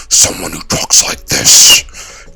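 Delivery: deep, slow, unvoiced demon growl; demonic voice. Shown as a demonstration. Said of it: "with a demonic voice".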